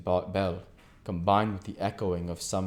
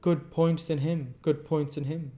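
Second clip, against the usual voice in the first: gentleman; 8 semitones higher, 4 dB louder than usual. Acted. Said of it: "said Cousin Raymond".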